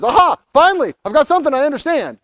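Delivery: a touch of gotcha in it; Pilate. Acted. Pilate pleased he finally understands, mock triumph. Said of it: "Pilate says".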